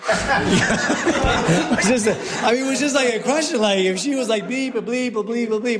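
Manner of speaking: high-pitched laughing talk, words bursting through laughter; cracking up at your own bluntness. Real, not acted. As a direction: laugh through it as you explain yourself. Laughing while retelling blunt question.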